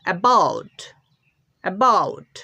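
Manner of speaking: অ্যাবাউট with a weak first অ্যা; dictionary pronunciation. Shown as a demonstration. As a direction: model অ্যাবাউট, light first syllable. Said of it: "লেখা হয়েছে". (অ্যাবাউট দুর্বল প্রথম অক্ষরে)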